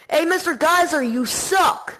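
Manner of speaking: loud high taunting yell. Retold or imitated. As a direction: yell it down the hallway. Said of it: "I yelled to him".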